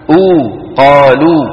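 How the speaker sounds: long back "oo", then قالوا ending in the same oo; pure Arabic waw. Shown as a demonstration. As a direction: show a clear rounded oo at the end of قالوا. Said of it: "شو عندنا؟". (واو عربية صافية في قالوا)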